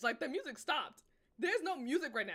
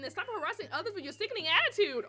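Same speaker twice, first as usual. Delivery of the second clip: louder, higher lecturing; cold scolding; 5 semitones higher, 6 dB louder than usual. Acted. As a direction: talk down at her sharply. Acted quarrel, Yuri scolds.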